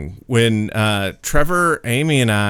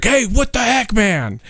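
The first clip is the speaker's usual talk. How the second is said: annoyed shout; 9 semitones higher than usual. Retold or imitated. Pedestrian yelling at driver.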